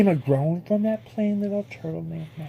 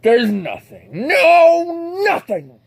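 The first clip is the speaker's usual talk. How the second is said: ranting turtle king; 7 dB louder, slower than usual. Acted. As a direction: lord it over him from the clouds. Yertle ranting at Mac.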